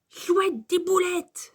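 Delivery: put-on high, delighted child's exclamation; Max s'exclame. A narrator's reading. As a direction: cheer for the lunch. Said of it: "s'exclame Max". (exclamation ravie, voix aiguë)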